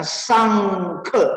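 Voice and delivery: "sangke" with both syllables falling; fourth tones. Shown as a demonstration. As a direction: say "sangke" in two sharp falling Mandarin tones. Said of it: "sama-sama nada keempat".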